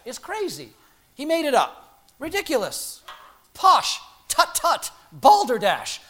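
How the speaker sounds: hammed-up dismissive scoffing, high and punchy; pooh-pooh it in loud, short outbursts; cranky scholars. Preacher mimicking cranky German scholars, scornful mock outrage. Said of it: "whatever it is that cranky German scholars used to say".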